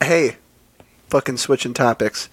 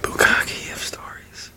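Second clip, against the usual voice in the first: mock whisper; 6 dB quieter, slower than usual. Joking whisper punchline.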